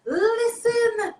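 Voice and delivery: high delighted cry of "listen"; excited cry. Real, not acted. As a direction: burst out in delight. Host reacts to Japan request.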